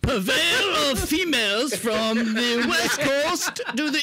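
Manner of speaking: high, slow hammed-up English accent; bad English accent. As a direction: announce the title like a mock English herald. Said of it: "his English accent need work".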